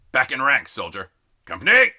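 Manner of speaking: loud barked orders; sergeant's command. A narrator's reading. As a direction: call the company to attention.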